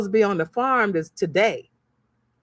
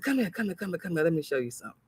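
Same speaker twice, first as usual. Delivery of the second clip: hushed calling over; 4 semitones lower, 9 dB quieter, much faster than usual. Retold or imitated. Calling workers over in mall.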